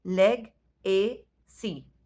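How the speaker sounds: "लेग, ए, सी" split slowly into three beats; syllable by syllable. Shown as a demonstration. Teacher to pupils, counting syllables of legacy, slowly. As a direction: drag out "लेग, ए, सी" one syllable at a time. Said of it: "count बनते हैं three".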